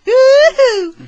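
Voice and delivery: very high, loud cheering whoop; excited. Real, not acted. Cheering Brady's intro.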